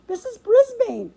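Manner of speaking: very high, loud squeaked call; squeaking hamster. A narrator's reading. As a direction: squeak for the teacher's attention. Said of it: "I squeaked".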